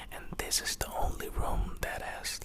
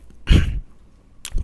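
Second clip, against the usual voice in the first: rough throat clear; 15 dB louder than usual. Real, not acted. Clears throat mid-sentence.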